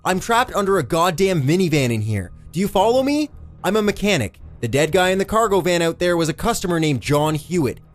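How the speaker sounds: high, urgent hollered plea; hollering. A narrator's reading. Eddie explains himself to the cop from the pit, hollering. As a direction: holler to make him listen. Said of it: "drew a deep breath and hollered".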